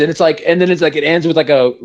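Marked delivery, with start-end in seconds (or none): none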